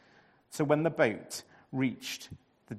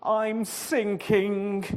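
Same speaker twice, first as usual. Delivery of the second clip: groaning; 7 semitones higher, 6 dB louder, much slower than usual. A narrator's reading. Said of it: "Jonah groaned".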